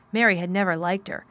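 ordinary delivery, nothing marked

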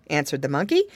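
plain reading voice

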